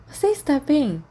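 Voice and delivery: put-on curious girl. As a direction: ask it with curious concern. Tina asks a worried question.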